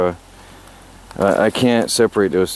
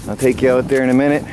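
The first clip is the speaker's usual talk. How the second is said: normal talking voice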